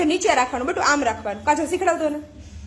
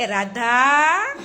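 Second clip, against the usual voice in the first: long drawn-out name, rising at the end; calling out; 2 semitones lower, much slower than usual. Real, not acted. Calling child to the camera.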